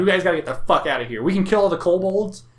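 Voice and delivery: loud, high, rushed warning; alarmed. Acted. Adventurer telling the group to get out, urgent alarm. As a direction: urge everyone to flee right now. Acted urging villagers to flee.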